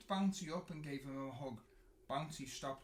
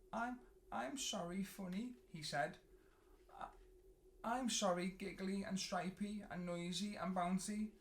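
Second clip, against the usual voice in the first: higher, halting sorry; sheepish apology; 5 semitones higher than usual. A narrator's reading. Snuggly apologising to his friends, higher and halting.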